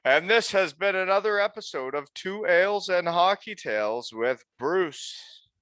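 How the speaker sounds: upbeat show sign-off; podcast outro. Acted. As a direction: close the episode like a host.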